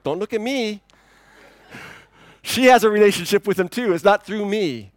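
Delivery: mock-defensive denial; defensive protest. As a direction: fend off the stares, half laughing. Classmates stare, he deflects.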